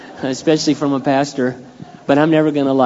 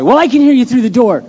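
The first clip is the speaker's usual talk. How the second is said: exaggerated yell; 9 semitones higher, 8 dB louder than usual. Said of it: "She wasn't really yelling that loud".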